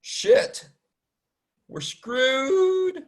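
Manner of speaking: high, drawn-out wail of fake doom; mock panic. Feigned panic over missing normality.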